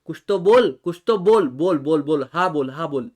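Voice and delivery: loud, insistent "कुछ तो बोल" pressing for an answer; begging someone loudly to say yes; pleading demand. Retold or imitated. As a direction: keep hammering "बोल" like you can't wait for a yes. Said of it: "उसके बाद में मैंने बोला".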